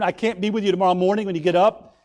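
usual speaking voice

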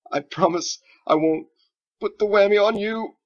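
high, loud words squeezed out between laughs; struggling through laughter. Acted. Wizard reassuring the frightened boy, laughing helplessly. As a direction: barely get it out for laughing. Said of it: "he struggled to say".

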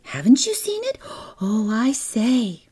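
high-pitched gushing schoolgirl chatter; girlish exclaiming. A narrator's reading. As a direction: exclaim it like an amazed little girl, high. Girls gossiping excitedly in the story.

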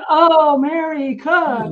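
hammed-up "oh, of course, her"; knowing recognition. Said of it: "they're like".

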